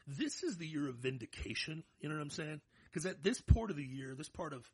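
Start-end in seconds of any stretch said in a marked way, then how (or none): none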